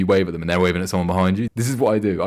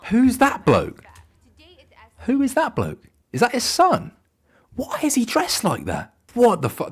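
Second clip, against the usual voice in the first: much higher, incredulous questions; baffled. Real, not acted. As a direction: ask in high baffled disbelief. Shocked at photo of Boris' son.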